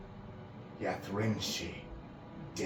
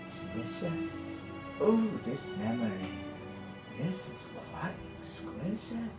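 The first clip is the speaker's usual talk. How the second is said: sweet pleasure; 8 semitones higher than usual. Acted. Priestess savoring Finn's memory, dripping with pleasure. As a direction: savor it like a delicacy. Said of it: "her words dripping with sweet pleasure".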